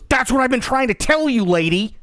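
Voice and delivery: loud, high, fed-up clerk; mock exasperated shout at the lady; exasperated punchline. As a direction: finally let it burst out.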